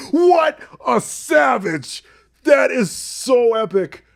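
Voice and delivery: high, slow, loud thrilled exclaim; thrilled by King Solomon's ruthless move; excited. Real, not acted. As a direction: gush over it in delight. Delighted by the story.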